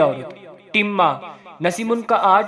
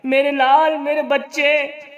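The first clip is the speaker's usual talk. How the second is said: higher, mildly tearful grandmother, slowing at the end; crying voice; 7 semitones higher than usual. Acted. Grandmother lamenting her stuck grandson in a mock-tearful voice. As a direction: weep over the child, a touch hammed-up. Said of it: "अम्मा रोने की आवाज़ में".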